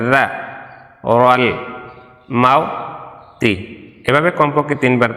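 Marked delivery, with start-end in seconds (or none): 0.1-0.2 রা said as a soft "dha"; tongue tip to upper teeth for রা, a soft th as in "this"; soft dhal. Shown as a demonstration. Teacher showing tongue on teeth, রা as soft dha. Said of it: "জালে উচ্চারণ নরম". 1.2-3.5 isolated syllables with long gaps; broken-up syllables. Shown as a demonstration. Teacher finishing the verse drill, broken syllable by syllable. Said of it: "ভাঙ্গা ভাঙ্গা উচ্চারণ করি".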